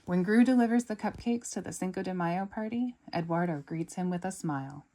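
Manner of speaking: plain reading voice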